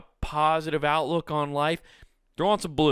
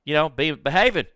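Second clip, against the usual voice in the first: louder, higher mock-Australian voice; Australian accent; 4 semitones higher, 5 dB louder than usual. Australian TV telling adults to behave, in a put-on Australian accent. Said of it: "it's Australian".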